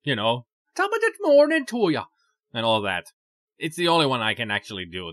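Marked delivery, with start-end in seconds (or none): 0.8-2.0 high, loud hammed-up Irish greeting; mock Irish cheer, much higher and louder. Shown as a demonstration. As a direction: throw out a cartoonish Irish brogue. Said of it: "when I do accents, especially if it's Irish, it's gonna be very stereotypical".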